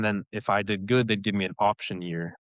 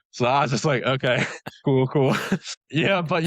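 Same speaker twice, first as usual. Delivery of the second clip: laughing; 5 semitones higher, 3 dB louder than usual. Retold or imitated. Nervous reaction laughing at procedure.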